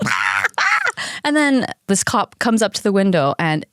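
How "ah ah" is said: yappy little dog noises; imitated dog barking. Frantic dog answers the owner's scream, imitated barking. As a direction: bark back like a frantic small dog. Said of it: "And he's like".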